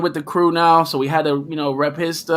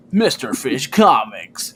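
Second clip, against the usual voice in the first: little announcer voice; 4 semitones lower, slower than usual. Pretend. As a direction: lower and slow, a light mock-announcer take. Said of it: "I did a little voice".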